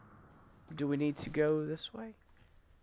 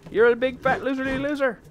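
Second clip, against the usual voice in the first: loud, high playground jeer; mock it gleefully to bait it; taunting; 9 semitones higher, 7 dB louder than usual. Player insulting the monster, taunting.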